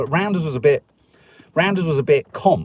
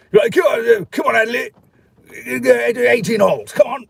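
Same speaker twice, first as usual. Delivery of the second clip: high, loud hearty posh bark; hammed-up posh dad calling him to the golf course; mock posh army father; 10 semitones higher, 5 dB louder than usual. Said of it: "It's making him sound posh".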